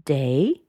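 "day" swooping up; unfinished, rising "day"; rising intonation. Shown as a demonstration. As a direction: lift "day" as if continuing. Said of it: "she continues on with".

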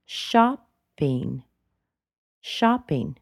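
"shopping" dropping sharply; falling intonation. Shown as a demonstration. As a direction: step down firmly through "shopping". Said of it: "It goes down rather sharply".

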